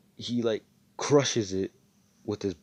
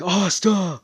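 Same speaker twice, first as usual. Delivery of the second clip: sudden loud cry, much higher; shouted name; 12 semitones higher, 11 dB louder than usual. Retold or imitated. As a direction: yell his name in shock. Friends cry out wounded Asta.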